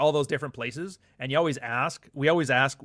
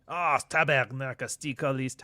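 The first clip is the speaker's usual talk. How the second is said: slow Québécois curse string; Quebec French swearing. Retold or imitated. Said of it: "the cuss word".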